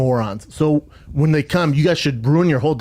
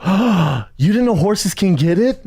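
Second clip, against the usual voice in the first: higher, taunting feigned shock; mock surprise; 7 semitones higher, 3 dB louder, slower than usual. Cyclist goads horse riders, mock surprise. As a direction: feign astonishment at their ignorance.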